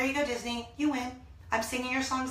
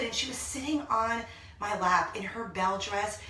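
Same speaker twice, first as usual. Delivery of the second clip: ordinary talk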